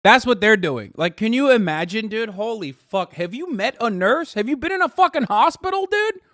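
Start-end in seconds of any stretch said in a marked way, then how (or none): none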